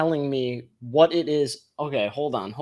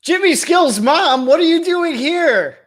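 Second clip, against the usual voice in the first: mock surprise; 11 semitones higher, 3 dB louder than usual. Mock surprise at a mom arriving.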